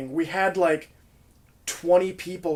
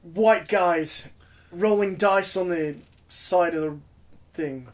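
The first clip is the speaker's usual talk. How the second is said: mock Australian accent; 2 semitones higher, slower than usual. Bandmate mimics an Australian onlooker at dice, mock Australian accent. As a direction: attempt a halting Australian accent. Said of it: "insert an Australian accent over that comment".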